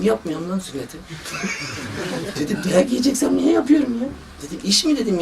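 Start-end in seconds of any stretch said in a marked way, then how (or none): 0.0-1.0 laughing. Retold or imitated. Retelling his reply, laughing. 1.0-2.3 a quiet, breathy laugh at his own story; soft laugh. Real, not acted. Laughs at own retold reply. 2.7-5.0 high, incredulous retort; exasperated doctor snapping back at the mother; indignant retort. Retold or imitated. Retells his indignant reply.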